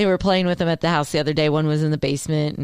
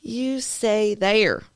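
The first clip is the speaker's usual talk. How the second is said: slow mock order; 4 semitones higher, much slower than usual. Mock telling Southerners stay.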